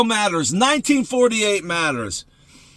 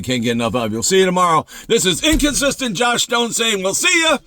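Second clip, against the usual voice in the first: ordinary talk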